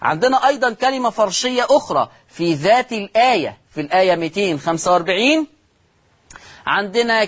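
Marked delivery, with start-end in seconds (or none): none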